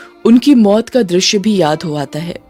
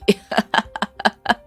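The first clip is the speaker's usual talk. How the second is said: softer villainous chuckle; acted evil laughter; gloating laugh; 2 semitones higher, 5 dB quieter than usual. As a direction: laugh cruelly at your victim. Villain gloats after threat.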